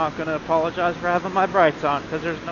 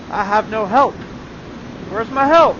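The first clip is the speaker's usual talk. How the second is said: higher, louder exasperated complaint; 5 semitones higher, 7 dB louder than usual. Real, not acted. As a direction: complain loudly into the dark. Complaining about no headlights.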